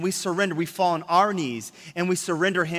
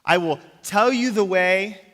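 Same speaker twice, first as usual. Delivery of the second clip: higher, slow, grand pronouncing; lightly voiced lofty teacher; 4 semitones higher, 6 dB louder than usual. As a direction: play a self-important guru, a bit raised. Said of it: "most religious leaders will say this".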